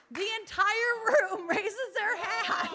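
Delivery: joyful laughing roar to the congregation; laughing excitedly. Real, not acted. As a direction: burst into laughter as you tell it. Excited crowd recounting a miracle.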